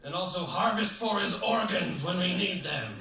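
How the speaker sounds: high, loud, slowing mock parent voice; proud parents. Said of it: "my parents are like".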